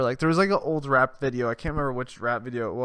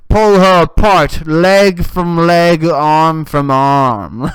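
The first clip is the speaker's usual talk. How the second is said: very loud, slow mock roar; hammed-up rage; 3 semitones higher, 14 dB louder, much slower than usual. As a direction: bellow each piece slowly, comically enraged. Imagined violent rage, hammed up.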